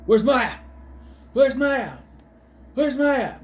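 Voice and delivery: high, loud mimicking of his fretting; mock single-minded worrier. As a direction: imitate him fixated on one thing. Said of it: "all he can think is".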